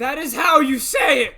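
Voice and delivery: high, loud outraged yell; indignant shout. Real, not acted. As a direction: protest loudly, scandalized. Defending pronunciation, indignant retort.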